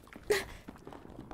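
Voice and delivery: high startled intake of breath; sudden frightened gasp; sharp gasp. Acted. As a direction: gasp as your foot slips. Slips on slick wall.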